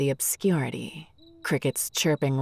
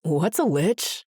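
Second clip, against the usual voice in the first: slightly raised, slow question; 3 semitones higher than usual. Acted. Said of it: "raising his voice a notch".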